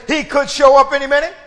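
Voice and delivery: call-and-response line. Shown as a demonstration. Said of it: "Come on, everybody say".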